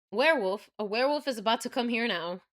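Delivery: normal talking voice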